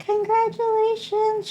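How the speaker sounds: high, sing-song congratulations; imitating congratulating doctor. Doctor congratulating expectant patient, high sing-song. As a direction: pitch it way up, sweetly celebratory.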